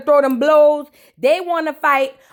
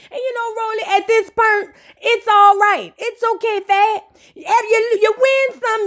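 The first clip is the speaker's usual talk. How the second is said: high, sing-song comforting voice; mock sweet reassurance, much higher; mock consoling; 7 semitones higher than usual. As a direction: soothe her like a child who lost. Consoling Rolly, mock sympathy.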